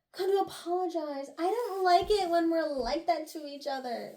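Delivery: high, pleading mock apology; mock plaintive apology. Acted heartfelt apology in story.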